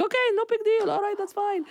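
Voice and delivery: high, forced-casual dad; mock calm dad. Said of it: "He's like".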